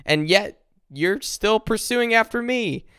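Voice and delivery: David crying out that he can't understand; exasperated plea. Retold or imitated. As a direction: plead with God, at a loss. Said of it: "he's even freaking out about".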